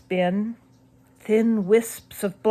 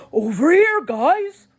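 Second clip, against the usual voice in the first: much higher and louder call across the attic; 11 semitones higher, 7 dB louder than usual. A narrator's reading. Dad calls the kids over to the new case, loud. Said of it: "Mister Malloy called".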